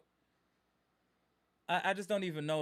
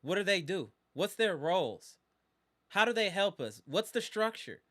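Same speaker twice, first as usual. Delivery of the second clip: ordinary talk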